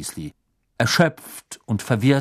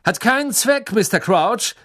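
high, louder call after someone; 11 semitones higher, 4 dB louder than usual. Acted. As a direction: call out to a man walking away. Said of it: "rief ihm Mister Diggory nach".

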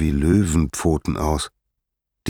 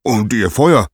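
building to a loud, raised-pitch boom; mounting, forceful outburst of a helpless god; thundering; 3 semitones higher, 4 dB louder than usual. Acted. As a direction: thunder in frustration as an acted Zeus. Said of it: "donnerte Zeus".

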